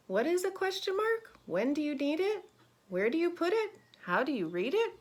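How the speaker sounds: "mark" and "it" lifting high at each question's end; sing-song storybook questions, rising at the end; questioning rise. Shown as a demonstration. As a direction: let each question climb on its last word. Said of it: "your voice kinda comes up".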